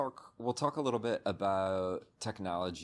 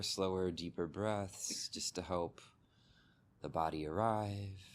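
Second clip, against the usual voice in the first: calm meditation guide, long pauses between phrases; unhurried, settling delivery, leaving space after each instruction; guided meditation. Real, not acted. Teacher guiding listeners to soften body and mind, guided meditation.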